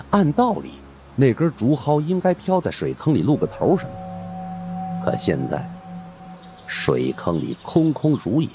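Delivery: usual speaking voice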